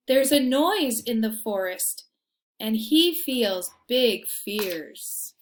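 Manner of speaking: lively storybook reading; reading to children. A narrator's reading. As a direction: read it like a bedtime picture book. Reading picture book aloud.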